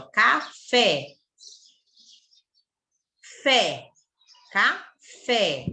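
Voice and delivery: "Café" split, with a strong falling FÉ; teaching demo, weight on FÉ in "Café"; stress on final FÉ. Shown as a demonstration. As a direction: separate the syllables of "Café" and land on the last. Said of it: "A última sílaba é que é a forte".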